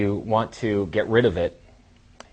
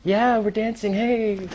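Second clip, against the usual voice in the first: high put-on girl's voice; mock girl at a club; 14 semitones higher, slower than usual. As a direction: chirp it up high like a partying girl. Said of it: "she wouldn't be there".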